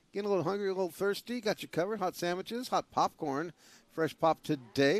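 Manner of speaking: concession stand plug. Acted. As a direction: sell the food like a quick ad read.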